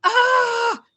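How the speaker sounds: loud high frustrated yell; sharp high shout of being fed up; exasperated shout. Retold or imitated. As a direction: let out a burst of frustration. Exasperated outburst after listing frustrations.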